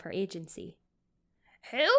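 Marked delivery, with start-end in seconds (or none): 1.7-1.9 high querulous question; querulous old woman. A narrator's reading. Old woman doubting the nurse, querulous. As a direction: whine it suspiciously. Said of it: "high-pitched and querulous".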